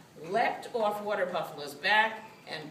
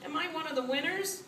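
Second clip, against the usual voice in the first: high, quick, worried question; anxious; 10 semitones higher, faster than usual. A narrator's reading. Cat hoping she is a winner, anxious quick high voice. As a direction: rush it out anxiously, pitched up. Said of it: "she asked anxiously".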